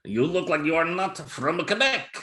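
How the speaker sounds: a lightly put-on Quebec French accent; a snooty Quebecer in a light Quebec French accent; mock Quebec French accent. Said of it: "that uppity Quebec accent".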